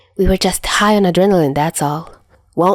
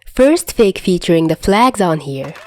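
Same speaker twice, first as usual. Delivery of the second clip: usual speaking voice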